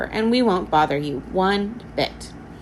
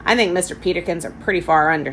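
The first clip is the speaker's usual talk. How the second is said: plain reading voice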